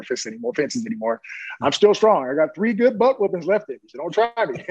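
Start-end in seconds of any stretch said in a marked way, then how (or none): 1.6-4.6 playful tough talk. Real, not acted. Boasting he can still fight.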